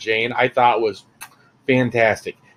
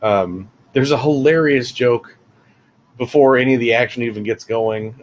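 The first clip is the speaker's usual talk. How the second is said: normal talking voice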